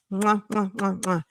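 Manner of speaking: soft blown kisses to the viewers; quiet smacking kisses; air kisses. Real, not acted. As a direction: blow kisses at the camera.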